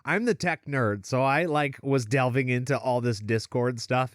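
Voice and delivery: usual speaking voice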